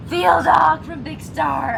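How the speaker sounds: high, excited squeal of a name; real fangirling. Real, not acted. Fan reveals the idol cast as brother, excited. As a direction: drag the name out, high and thrilled. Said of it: "I'm fangirling a little bit".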